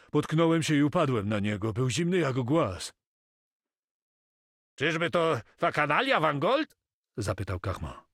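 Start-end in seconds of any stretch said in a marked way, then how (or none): 0.1-2.9 raised, louder agitated telling; alarmed report. A narrator's reading. Moko tells his master about the corpse, alarmed. 4.7-6.8 high, loud eager question; excited suspicion. A narrator's reading. Carmo asks if the corpse is Wangold, agitated. Carmo suspects the hated enemy.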